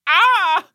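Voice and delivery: very high, loud yelp of pain; mock cry of pain; pinched yelp. Acts being pinched.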